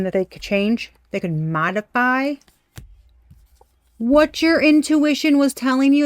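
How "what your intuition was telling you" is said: excited. Real, not acted. Excited exclamation in reading.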